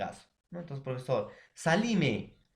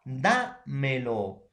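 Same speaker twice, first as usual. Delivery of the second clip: "dámelo" said very slowly, stress on DÁ; teacher stretching out "dámelo"; slow demonstration; much slower than usual. Shown as a demonstration. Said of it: "si lo combino más me, más lo".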